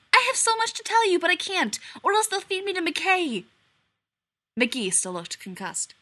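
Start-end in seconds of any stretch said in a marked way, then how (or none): 0.1-3.5 very high, breathless babble; excited rush. A narrator's reading. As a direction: spill it all out at once. Abby breathless after ordeal.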